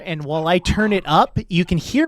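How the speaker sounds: mic level jumping up; sudden loud boost from auto gain; uneven auto gain. Real, not acted. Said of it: "I've got an auto gain on here".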